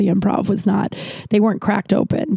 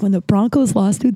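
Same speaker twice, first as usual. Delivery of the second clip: normal talking voice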